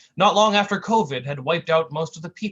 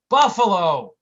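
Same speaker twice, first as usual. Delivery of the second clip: annoyed correction; 4 semitones higher, 9 dB louder, slower than usual. Acted. Tough guy correcting loudly.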